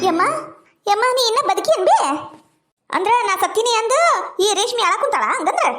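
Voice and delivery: laughing disbelief; amazed. Acted. Grandmother amazed she was mourned. (ನಂಬಲಾಗದ ಅಚ್ಚರಿ)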